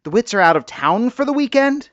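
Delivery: high, loud rising question in dawning shock; alarmed realization. Acted. Wife realizing the neighbors' car is being stolen, shocked. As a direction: gasp it out as the truth hits.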